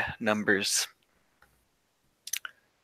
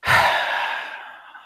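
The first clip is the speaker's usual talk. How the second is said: long, loud exhale; heavy sigh; 7 dB louder than usual. Acted. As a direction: let out a big breath before speaking. Character resolving to hunt them down.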